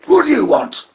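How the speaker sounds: high furious bark at the door; angry neighbour. Acted. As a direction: snap at the kids like a raging old man. Grumpy neighbour answers kids.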